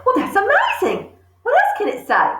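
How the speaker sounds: high, amazed and laughing; thrilled little girl; delighted. Acted. Matilda amazed by the talking parrot, laughing high.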